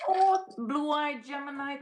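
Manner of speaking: high, loud, delighted hello to a viewer; light up as you spot a viewer's gifts; excited greeting. Real, not acted. Host calls out to a gift-giving viewer, excited.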